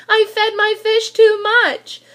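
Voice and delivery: frantic confession; panicked. Acted. Boy blurting to the policeman on the phone, panicked.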